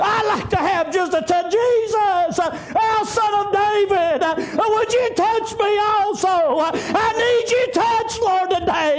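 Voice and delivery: high, driving revival preaching, gasped breaks between phrases; full-force preaching. Acted. Preacher retelling Jesus healing a crippled man, full-force preaching. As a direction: preach it out in shouted, rhythmic bursts.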